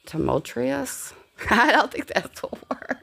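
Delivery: high, giggly disbelief at the word Tumultuous; laughing at herself. Real, not acted. Vlogger laughing at her own vocabulary, giggling. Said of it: "Is that a fucking word?".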